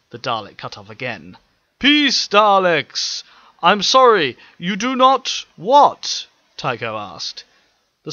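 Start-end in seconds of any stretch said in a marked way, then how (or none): 1.8-6.3 higher, louder, slow official alien mayor voice; formal, careful welcome to strangers; polite alien mayor. Acted. Mayor Tycho welcoming the machines, formal high slow voice.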